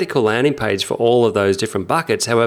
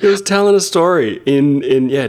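excited; 9 semitones higher than usual. Real, not acted. Raving about Ryan's dialect performance.

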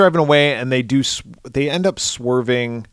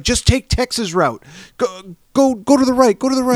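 high, urgent, bossy directions; mock panicked backseat driver; frantic navigator; 12 semitones higher than usual. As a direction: bark the turns in a panic. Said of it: "And he's like".

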